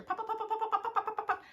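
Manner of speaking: rapid, high, loud pops; quick bright popcorn pops, high and loud; fast popping sounds. Shown as a demonstration. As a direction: pop pop pop like popcorn going wild. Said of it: "A little faster".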